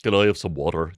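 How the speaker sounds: using the Voice. Retold or imitated. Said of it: "did it with, like, the fucking voice".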